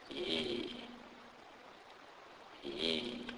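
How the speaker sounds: "Yi" as a soft, held "yee"; letter sound demo. Shown as a demonstration. Teacher shows how Y is pronounced, soft long "Yi". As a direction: sound out "Yi" softly, glide as in "yo-yo". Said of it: "Y in the word yo-yo".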